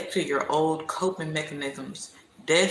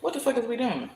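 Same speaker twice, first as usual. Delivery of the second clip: talking to herself; 7 semitones higher than usual. Retold or imitated. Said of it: "I start being like".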